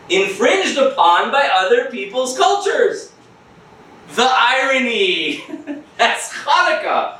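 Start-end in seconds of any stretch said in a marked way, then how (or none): 4.1-7.2 slow, amused, laughing. Real, not acted. Teacher laughing at how fitting the story is. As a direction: chuckle through the irony. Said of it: "laughing at irony".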